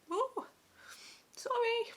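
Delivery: high, teary "Ooh, sorry" as tears come; catching herself in a sudden high sob; tearful apology. Real, not acted. Telling viewers about labour, breaking into tears, high. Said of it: "Why am I getting emotional?".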